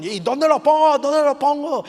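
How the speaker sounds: high, loud mock yell; imitated panicked climber. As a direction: act out a stuck climber shouting for help. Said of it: "le empieza a gritar a sus compañeros".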